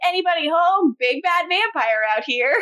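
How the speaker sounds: high-pitched mock taunting call; mock yell. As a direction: call out like a villain looking for company, without real force. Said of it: "yells and is like".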